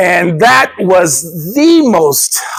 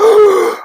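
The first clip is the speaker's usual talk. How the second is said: sharp high gasp; shocked gasp; 4 dB louder than usual. Retold or imitated. Husband reacting to wife's divorce line, high gasp. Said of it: "I'm like".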